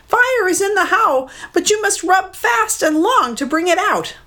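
a bird encouraging her captor; mud hen. Acted. Mud hen guiding Maui, high bird voice.

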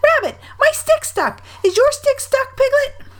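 put-on very high piping child voice; squeaky little Roo. A narrator's reading. Roo asks Piglet about stuck sticks, high squeak. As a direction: call to friends in a tiny high squeak.

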